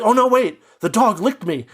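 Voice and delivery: mock-frightened cry, pitched high; mock panic. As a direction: play at sudden horror over a small detail. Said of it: "he's like".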